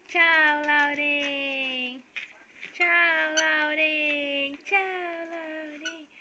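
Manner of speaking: long drawn-out farewell call; stretched vowels, as if calling from afar; calling out goodbye. Pretend. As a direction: call a singsong goodbye, starting loud and fading.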